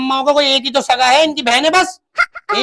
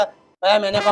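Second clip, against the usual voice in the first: normal talking voice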